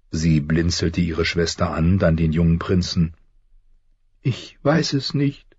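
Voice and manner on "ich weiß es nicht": in tears. Acted. Sansa answers her father before the king, slow and tearful. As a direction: let it come out small and tearful. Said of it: "sagte sie unter Tränen".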